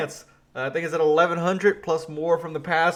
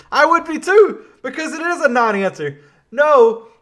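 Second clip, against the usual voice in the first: much higher and louder, worked up; heated rant; 8 semitones higher, 7 dB louder than usual. Real, not acted. Agreeing with Trump about the non-answer, in an indignant outburst.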